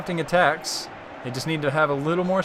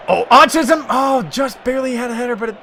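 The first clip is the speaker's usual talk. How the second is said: higher, louder sudden caster exclamation; excited commentary; 8 semitones higher, 6 dB louder than usual. Real, not acted. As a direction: jump on the near miss. Near miss header, live commentary.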